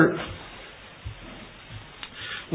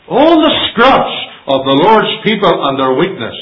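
loud, higher, slowed preaching; full-force preaching; 10 semitones higher, 7 dB louder than usual. Acted. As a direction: preach it out to the hall.

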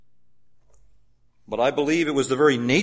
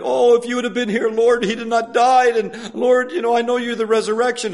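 high, louder wailing plea; Mary and Martha pleading with the Lord, high mock lament; mock crying sisters; 8 semitones higher, 4 dB louder than usual. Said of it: "and they're".

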